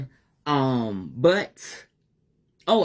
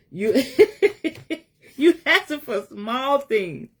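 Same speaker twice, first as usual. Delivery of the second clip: high, bitter laugh running into the words; real rueful laughter over the words; laughing through it; 10 semitones higher, 4 dB louder, slower than usual. Real, not acted. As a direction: laugh it out, slow and a little pained. Laughs at her own hurt.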